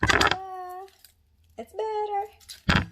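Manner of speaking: high, drawn-out sing-song ta-da; playful reveal. Real, not acted. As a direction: show it off in a high, stretched lilt. Showing off the new onion.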